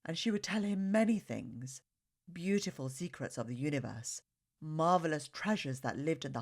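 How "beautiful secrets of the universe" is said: ordinary delivery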